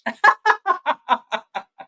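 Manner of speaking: bright laughing peal up high; real delighted laugh at the show; high burst of laughter. Real, not acted. Fan laughing at show moment.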